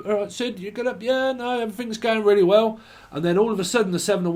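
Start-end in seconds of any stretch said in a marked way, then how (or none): 0.1-2.8 high-pitched mock friendly chat; hammed-up cheery check-in; chirpy small talk. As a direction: act out an all's-well exchange, pitched up. Acts a chirpy exchange.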